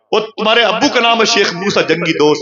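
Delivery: high, excited questions one after another; a stranger's rising, excited questions; eager questioning. Acted. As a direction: ask it like you can hardly believe it.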